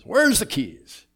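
high, slow shouted demand; yelling wife. Retold or imitated. Wife yelling at her husband about keys, loud. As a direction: yell it at the husband, drawn out. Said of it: "she yells".

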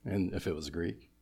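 normal talking voice

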